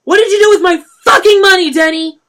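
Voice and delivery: a furious dealer shouting; shouted. A narrator's reading. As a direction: bellow it at him. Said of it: "Chris R shouted".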